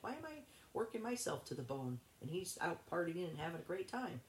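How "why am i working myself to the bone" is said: high-pitched retold exasperation; exasperated complaint. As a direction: replay your own fed-up question, pitched up. Retold exasperation at partner's partying.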